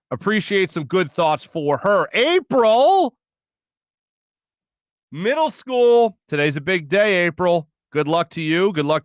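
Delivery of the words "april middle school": higher, louder, drawn out proud shout-out; calling out to daughter. Real, not acted. Host calls to daughter starting middle school, excited.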